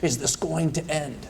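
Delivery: soft, slow, strained plea from labor; retold pained plea. Husband retelling his wife's plea during labor, soft strained moan. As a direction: imitate her worn-out moan, softly and slowly. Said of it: "My wife's gripping the side ... tub there going".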